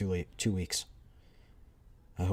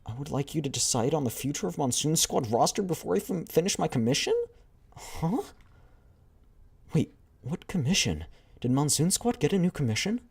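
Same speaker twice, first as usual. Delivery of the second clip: higher, puzzled reading; confused younger girl; 9 semitones higher, 3 dB louder than usual. Acted. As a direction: squint at the message in confusion. Younger girl reads message, confused.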